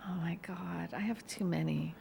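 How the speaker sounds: soft whispered aside. Real, not acted. To a friend asking for a funny story, a whispered aside. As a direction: breathe it out as a secret. Aside about too many stories.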